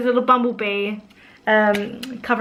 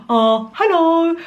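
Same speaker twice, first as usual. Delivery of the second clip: slow, higher, louder goofy character voice; Norman; 6 semitones higher, 4 dB louder, slower than usual. Acted. Mum voicing a storybook bear, silly drawn-out voice. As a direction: draw out a silly put-on greeting. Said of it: "why Norman talks like that".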